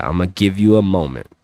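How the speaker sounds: low, hushed reflective guide voice; calm inner-work guidance; guided reflection. Acted. Leads a quiet reflection.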